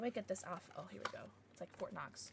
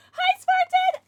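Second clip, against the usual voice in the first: excited greeting; 16 dB louder than usual. Real, not acted. Greeting a chat viewer.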